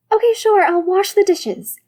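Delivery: high, eager, pleading; eagerly bargaining. Acted.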